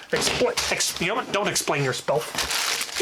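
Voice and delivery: ordinary talk, nothing marked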